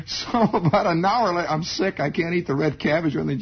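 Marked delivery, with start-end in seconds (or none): none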